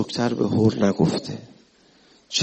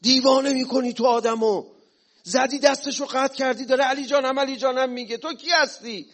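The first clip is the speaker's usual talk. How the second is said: high, indignant outburst; jealous Khariji confronting Ali, pitched high; mock angry shout; 11 semitones higher than usual. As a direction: hurl the question at him. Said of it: "داد زد".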